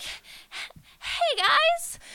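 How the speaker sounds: high, strained cheery greeting to cover panic; fake-casual high greeting; sheepish high hey guys. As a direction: squeak it out like nothing's wrong. Nervous greeting to his group.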